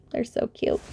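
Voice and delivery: soft unvoiced whisper. Real, not acted. Whispering to viewers about her shiny snowmen. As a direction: breathe it out fondly.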